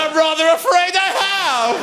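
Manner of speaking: high, prim, apologetic voice; prim schoolgirl impression. Pupil answering her teacher, high and prim. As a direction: admit it politely like a 1940s housewife. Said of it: "She said".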